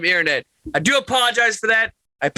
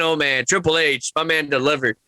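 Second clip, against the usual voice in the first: normal talking voice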